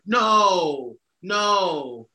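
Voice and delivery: long, loud quoted "no" of objection; emphatic refusal. Retold or imitated. Imagined crowd refusing what is coming, emphatic mock protest.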